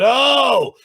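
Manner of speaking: hammed-up mock of a fussy critic; mocking whiny imitation. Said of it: "he just goes on and on about".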